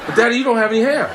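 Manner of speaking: high, loud, fast gleeful jab; taunting son. Retold or imitated. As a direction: deliver the punchline high and quick. Son's punchline taunt.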